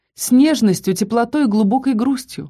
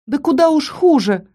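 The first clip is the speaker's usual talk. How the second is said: higher, exasperated retort; squeezed out; 6 semitones higher than usual. A narrator's reading. Heroine retorting to the threatening count, higher and forced. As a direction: force it out in protest. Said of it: "выдавила я".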